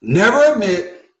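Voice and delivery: "Never admit" slow, high and loud, punched hard; stress on NEVER ADMIT. Shown as a demonstration. Lawyer drilling viewers with the phrase, loud and slow. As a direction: hammer the words "Never admit" home. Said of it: "So just remember this phrase".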